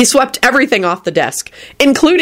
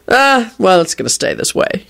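offhand reply, dropping away; casual. A narrator's reading. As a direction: shrug it off casually. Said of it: "he replied casually".

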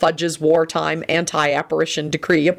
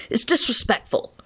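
cross; 6 semitones higher than usual. A narrator's reading. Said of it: "Lupin said crossly".

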